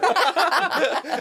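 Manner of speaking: high burst of laughter; loud laugh. Real, not acted. Laughing at accent story.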